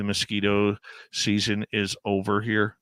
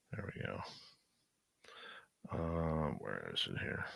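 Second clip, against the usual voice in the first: very soft, low talking to oneself; muttering while searching; 5 semitones lower, 16 dB quieter than usual. Real, not acted. Host looking for a video to show the guest, muttering. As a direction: murmur absently as you hunt for something.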